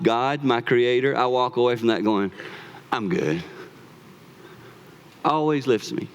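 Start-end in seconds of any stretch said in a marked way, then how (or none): 2.9-3.4 low, soft and satisfied; quiet lower contentment; content and settled. Retold or imitated. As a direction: let it settle down into peace. Contentment after time with God.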